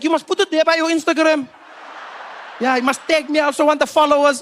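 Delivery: high, loud South African fan; insistent, high local-guy voice; pushy street fan. Acted. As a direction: talk the star into it, excitedly.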